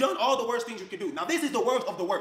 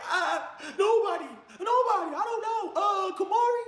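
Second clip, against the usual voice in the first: put-on much higher, slow, whimpering child's voice; imitating crying child; 13 semitones higher, slower than usual. Father imitates his crying daughter being questioned. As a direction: squeak it out like a scared little girl. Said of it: "My daughter crying".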